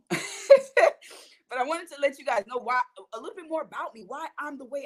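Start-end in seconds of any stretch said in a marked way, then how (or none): 0.2-1.2 high, bursting nervous laugh; embarrassed laugh. Real, not acted. Embarrassed after emotional speech.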